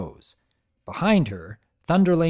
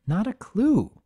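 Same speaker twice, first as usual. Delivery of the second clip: ordinary delivery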